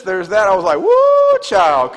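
ordinary talk, nothing marked

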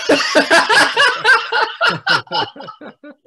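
a big high burst of laughter; hearty laugh. Real, not acted. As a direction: laugh out loud at the memory. Laughing at rival comedian.

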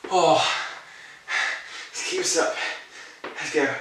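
breathless and winded mid-workout; gasping out the words after exertion; adrenaline shakes. Real, not acted. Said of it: "my heart is racing".

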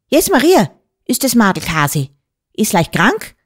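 high exclamation, then settling into questions; a touch of astonished dialect voice; startled forester. A narrator's reading. Forester spotting the girl at the table, startled.

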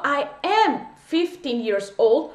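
put-on "I am fifteen years old" drawn out slowly; slow model phrase. Shown as a demonstration. Teacher repeating the correct English for students slowly. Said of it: "en inglés yo digo".

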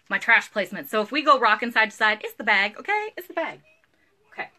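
ordinary talk, nothing marked

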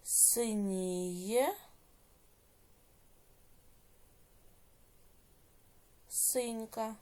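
slow, drawn-out syllables with rising ends; dictation. Shown as a demonstration. Teacher dictating exercise eight to pupils, slow measured dictation. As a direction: dictate each word slowly, leaving long writing pauses.

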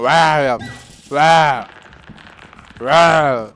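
loud scaring yell; shout to scare. Pretend. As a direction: bark it out to scare. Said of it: "bağır buna, korkut bunu". (korkutmak için bağırış)

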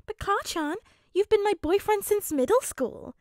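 high, loud whine of protest; mock-hurt protest. Ashido teasingly protests the rejection.

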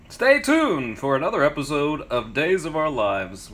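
lightly voiced TV announcer. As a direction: sign off like a soap promo. Jokey soap opera sign-off.

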